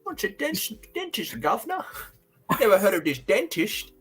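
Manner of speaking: high, hammed-up fake British accent; bad British accent. Mocking British teeth in an over-the-top British accent. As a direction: ham up a Brit who's baffled by dentists. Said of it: "trying to put on a British accent".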